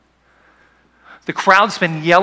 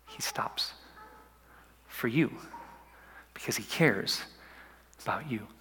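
whisper; 3 semitones lower, 13 dB quieter than usual. Acted.

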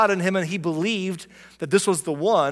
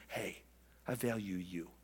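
much lower and softer, nearly murmured; intimate affirmation; 8 semitones lower, 16 dB quieter than usual. Acted. Someone telling you to your face, quietly sincere. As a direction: tell one person privately, gently. Imagined praise said directly to you.

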